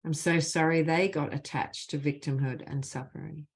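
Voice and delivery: measured, deliberate leading of a phrase; guided healing phrases. Acted. Facilitator gives the group apology phrases to repeat, measured and deliberate. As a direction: lead the group line by line, leaving space after each.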